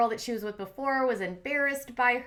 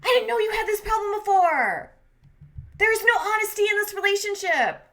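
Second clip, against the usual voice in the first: loud, high mock yelling; irate freak-out; 10 semitones higher, 5 dB louder than usual. Retelling Steph getting off the bed, irate freak-out. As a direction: imitate an irate girlfriend blowing up. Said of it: "Steph freaks out".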